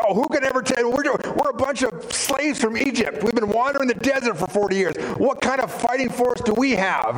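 high-pitched, incredulous objection; mock dismay, pitched well up; daunted protest. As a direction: imitate a crowd protesting they can't. Voicing the daunted Israelites' objection.

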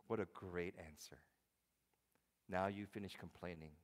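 ordinary delivery

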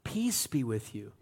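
low, hushed blessing; soft peace. Retold or imitated. Jesus blessing frightened disciples, low and hushed. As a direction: breathe it gently over them. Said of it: "He said to them again".

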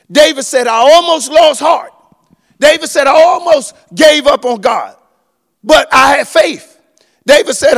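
loud, high, climbing sermon delivery; full-force preaching. Acted. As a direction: preach it out to the whole church.

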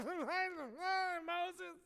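high whining call through a laugh; mock the complaining crowd whining his name; whiny crowd calling. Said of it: "a million people at the same time are like".

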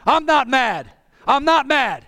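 ordinary talk

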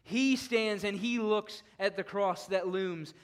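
ordinary talk

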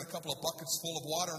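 normal talking voice

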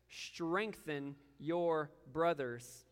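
drawn-out solemn emphasis; slow, weighted charge. Acted. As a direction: stretch each word of strengthen your brothers. Repeating Jesus's charge, drawn out.

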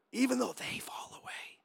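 whispered aside; hushed, acted stage whisper; confidential whisper. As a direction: whisper it so the others don't hear. Peter's aside about the other disciples.

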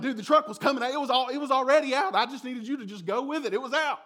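mock-outraged, reliving the old argument; heated insistence. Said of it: "I'm like".

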